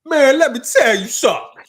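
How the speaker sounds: high, loud mock outburst; mimicked outburst. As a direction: blurt it out high and loud, imitating a comic. Retold heckle from comedian on stage.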